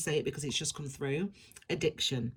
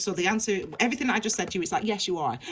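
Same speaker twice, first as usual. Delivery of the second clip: usual speaking voice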